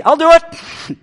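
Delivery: high, loud eager volunteering. Pretend. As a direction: jump at the chance, thrilled. Eager volunteer jumping at call.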